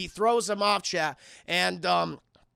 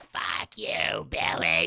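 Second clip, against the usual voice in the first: lower, rasped creature taunt; gremlin villain voice; 4 semitones lower, much slower than usual. Retold or imitated. Streamer as Stripe taunting Billy, rasping gremlin voice. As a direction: rasp it like a spiteful little monster.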